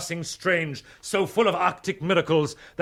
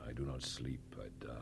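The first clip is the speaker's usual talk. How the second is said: much softer and lower, drained; hollow murmur; 17 dB quieter, faster than usual. Acted. Captain waking from his nightmare to Ridley, murmured. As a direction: speak it from the edge of sleep.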